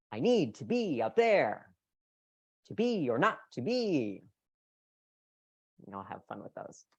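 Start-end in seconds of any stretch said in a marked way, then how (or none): 0.1-4.2 loud, high, sweeping melodic line; dramatic up-and-down reading; exaggerated intonation. Shown as a demonstration. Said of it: "try it at home".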